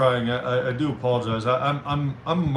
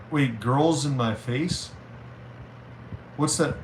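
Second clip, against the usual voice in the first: ordinary talk, nothing marked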